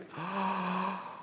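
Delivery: high breathy yawn inhale; yawning gasp. Shown as a demonstration. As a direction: breathe in through a big yawn. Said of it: "we're gonna yawn".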